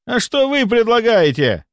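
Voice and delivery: very high, desperate question; exasperated howl. A narrator's reading. A thwarted doctor howls back at his critic, exasperated. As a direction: wail the question in frustration. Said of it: "взвыл он".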